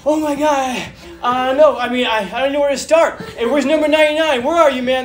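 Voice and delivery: high, loud, laughing joy; ecstatic winner. Retold or imitated. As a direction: gush like a champion. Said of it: "He's like".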